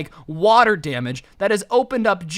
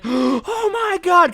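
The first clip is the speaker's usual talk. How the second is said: much higher and slow, drawn-out shock; terrified oh my god; 11 semitones higher, 4 dB louder, much slower than usual. Retold or imitated. Visitor seeing a face in the dark attic, shocked. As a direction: stretch it out in horror. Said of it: "they freak out and are like".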